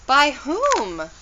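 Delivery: high-pitched, curious query; eager question. A narrator's reading. Nancy asks who sent the present, high and curious. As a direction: ask it high, wanting the name. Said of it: "Nancy asked".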